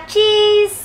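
high, loud, long-held "cheese"; photo-time cheese. Shown as a demonstration. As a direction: call it out for the camera, stretched. Photo pose call to smile.

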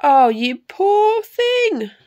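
high, loud, slow sympathy; big high-voiced motherly giantess; booming giantess. A narrator's reading. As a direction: boom out pity, drawn out. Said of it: "boomed the giantess".

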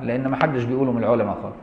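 ordinary talk

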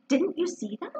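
gently taken aback; a little surprised. Acted. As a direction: ask with mild surprise, pitch rising. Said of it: "a little surprised".

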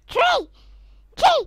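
high, loud little yelp; sudden high-pitched burst; excited outcry. Real, not acted.

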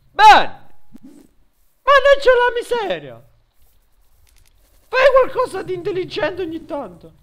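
high, loud hammed-up rage; mock furious shouting. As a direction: yell at the pet in exasperation. Furious at disobedient pet.

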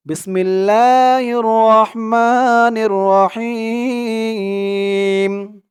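slow, melodic tajweed chant; Arabic recitation as a model for learners; Quran recitation. Shown as a demonstration. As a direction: chant each verse slowly with long held vowels. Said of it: "reading টেনে টেনে বলে দেব".

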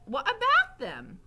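high, loud question; Mig. A narrator's reading. Mig still puzzled by Gregory, high loud voice.

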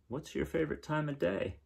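ordinary delivery

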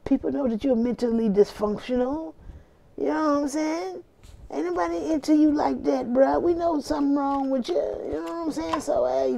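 high put-on voice of a dismissive homeboy; mocking street friend. Reader roasting the ex as a friend, high mocking voice. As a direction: clown on him like your boy would.

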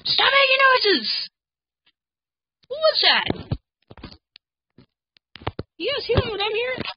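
high scared cries; panicked. Real, not acted. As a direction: shriek it, frightened. Panicking at noises.

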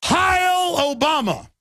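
a slow mocking "Heil Obama" cry, high and drawn out; mock fascist salute. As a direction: chant it like brainwashed followers saluting. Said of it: "And they say".

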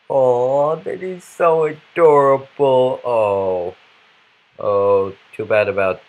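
high, drawn-out adoring coo. Pretend. Player imagining the dog, adoring coo. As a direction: gush slowly as if over a puppy.

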